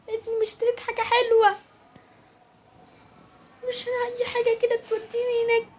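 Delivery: put-on high tearful child's voice, sobbing; lost little kid whimpering to go home; wailing lost child; crying child.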